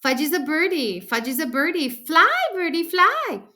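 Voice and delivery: put-on high, loud little-boy singsong, climbing to a squeal; gleeful toddler, building; excited toddler. A narrator's reading. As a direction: crow it like a small child about to jump. Toddler playing bird, about to jump.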